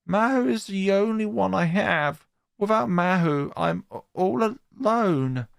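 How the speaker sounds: put-on high, small girl voice; a girl telling her teacher about her fight; tearful schoolgirl.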